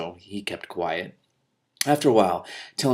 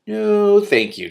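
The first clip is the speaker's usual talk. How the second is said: firm; 12 semitones higher, 9 dB louder, much slower than usual. A narrator's reading. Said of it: "said firmly".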